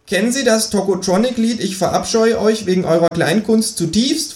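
high, cheeky kangaroo; quizzing with a high, cheeky edge. Acted. The kangaroo quizzes the narrator about a song, high and cheeky. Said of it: "fragt das Känguru".

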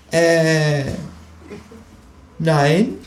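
high, hesitant kangaroo denial; caught kangaroo. Acted. Kangaroo caught with its paw in the food, high hesitant. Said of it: "sagt das Känguru".

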